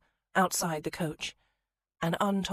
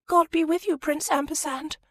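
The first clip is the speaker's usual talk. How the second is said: high, loud tearful farewell; weeping lady; 12 semitones higher, 7 dB louder than usual. Acted. The weeping lady bids Prince Ampersand farewell in a high, loud tearful wail. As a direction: wail a teary goodbye, pitched high. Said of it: "weeping into her handkerchief".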